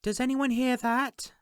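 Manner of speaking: put-on high young woman's voice calling down the hall; Fee calling out. A narrator's reading. Fee calls down the hall to the others, high and carrying. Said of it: "she called down the hall".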